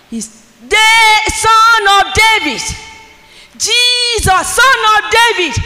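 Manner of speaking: high, loud crying out to Jesus; Bartimaeus shouting. Retold or imitated. Preacher voicing the blind man shouting for Jesus, loud. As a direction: call out to him at the top of your voice. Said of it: "He was shouting".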